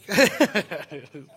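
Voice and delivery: big hearty laugh; loud laugh. Real, not acted. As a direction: burst of laughter. Laughs at own joke.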